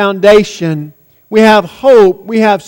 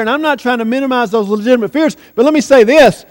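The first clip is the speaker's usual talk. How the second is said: usual speaking voice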